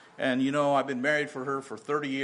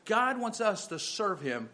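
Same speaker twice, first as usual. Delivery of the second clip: raised, louder preaching voice; 6 semitones higher, 4 dB louder than usual. Acted. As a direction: preach it out with rising fervor. Preaching at full force.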